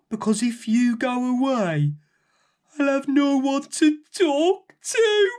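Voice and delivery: high, tearful giant voice; crying giant. A narrator's reading. Lonely giant tells Rose why he is crying, tearful. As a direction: sob it out, afraid of being alone.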